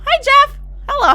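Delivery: high, loud hello; excited greeting. Real, not acted. Streamer greeting Jeff in chat, excitedly. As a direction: call it out high and bright to a newcomer.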